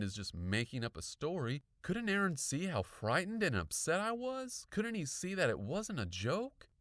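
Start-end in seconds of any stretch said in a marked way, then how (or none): 1.8-6.7 higher-pitched, aggrieved questions; fume, wanting to be believed; indignant. A narrator's reading. Girl fuming about her friend.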